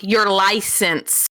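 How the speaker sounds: very slow, drawn-out explanation; translating for Mike. Retold or imitated. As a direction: stretch it out as if translating. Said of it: "I had to translate for Mike".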